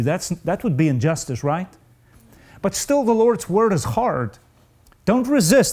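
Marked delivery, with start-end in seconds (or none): 2.6-5.7 much higher, pleading over a hard word; strained high plea. Real, not acted. Preacher wrestling aloud with a hard command, pitched high. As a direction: push the voice up as if it pains you.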